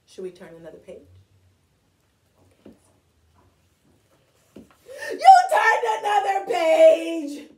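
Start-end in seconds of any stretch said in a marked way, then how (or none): 5.1-7.5 high, drawn-out storybook yell; outraged shout. Acted. Grover sees the page was turned, shouting in protest. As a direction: cry out in hammed-up horror.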